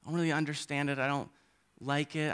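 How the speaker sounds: put-on very soft, hesitant child's confession; timid little kid. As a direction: recall a small child's uneasy awe, very softly. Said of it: "as a little kid, but it was just".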